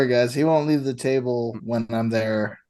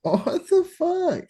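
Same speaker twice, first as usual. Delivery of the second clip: much higher, slow incredulous cry; stunned disbelief; slower than usual. Real, not acted. Friend reacting to the German guy's playing, high stunned cry. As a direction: drag it out in total disbelief.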